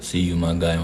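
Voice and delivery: usual speaking voice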